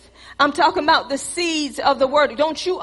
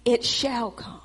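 "It shall come" repeated much softer; soft echo; 3 semitones lower, 6 dB quieter than usual. Shown as a demonstration. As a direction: drop the echo to a hush. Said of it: "and it shall come".

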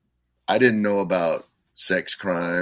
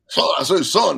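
lightly voiced Southern rooster, a touch louder; Foghorn Leghorn; 7 semitones higher, 6 dB louder than usual. Imitating the cartoon rooster for the interviewer, in a light Southern accent. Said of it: "he's like ... a Southern guy".

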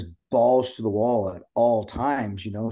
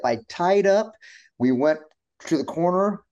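usual speaking voice

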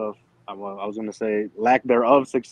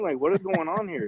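ordinary delivery

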